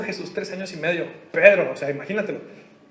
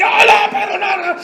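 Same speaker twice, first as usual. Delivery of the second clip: high, loud scolding shout; mock scolding; 10 semitones higher, 7 dB louder than usual. Paul rebuking Peter, loud mock scolding shout. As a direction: tell Peter off, hammed-up. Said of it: "Y lo regaña".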